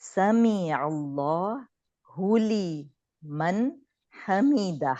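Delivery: سمع اللہ لمن حمدہ drawn out very slowly with a clear عین; careful correct reading. Shown as a demonstration. Teacher showing the correct سمع اللہ lmn حمدہ slowly. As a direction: stretch each word of سمع اللہ with the ain sound. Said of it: "عین کی آواز نکلنی چاہیے".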